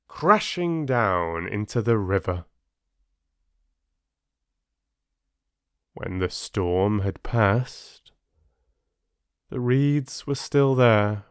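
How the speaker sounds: slowing, sombre fable narration fading out; mournful close of the oak's story. A narrator's reading. Fable's sad ending.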